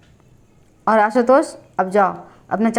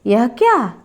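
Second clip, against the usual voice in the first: understated startled fear; frightened; slower than usual. A narrator's reading. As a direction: ask it with a touch of alarm. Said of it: "मैं भयभीत भाव से कह उठा". (हल्के भय से चौंककर)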